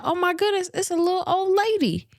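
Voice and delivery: high-pitched mock dismay; innocent concern. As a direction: exclaim high, as a helpful passer-by would. Suspect acts out innocent concern.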